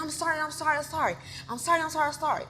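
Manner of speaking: high, looping apologies; pleading apology. Retold or imitated. Retells begging the attacker.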